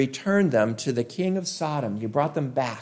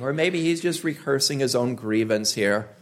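usual speaking voice

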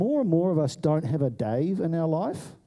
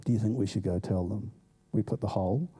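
hushed hiding; 7 semitones lower, 6 dB quieter than usual. Retold or imitated. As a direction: lower and softer, two friends hiding. Hiding in the bush.

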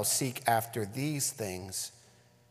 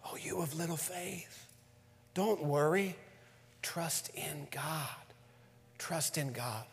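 tender hush; 2 semitones higher, 7 dB quieter than usual. Acted.

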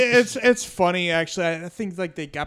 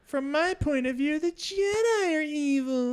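Anakin impression; 11 semitones higher, slower than usual. Quoting Anakin's bad line.

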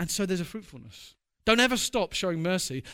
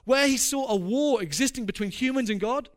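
louder, higher, impassioned preaching build; preaching at full force; 5 semitones higher, 4 dB louder than usual. Acted. Preacher ends his sermon on the cross, loud and impassioned. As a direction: drive home the cross with fervour.

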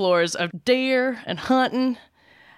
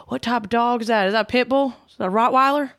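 imitated eager strangers, higher voice; nosy passers-by; 5 semitones higher, 4 dB louder than usual. Mimicking strangers who stop a dog owner, higher eager questioning. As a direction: rattle off questions like pestering passers-by. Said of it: "I got stopped every five seconds".

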